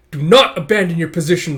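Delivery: loud captain's order; commanding shout. Acted. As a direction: call out firmly to the troops.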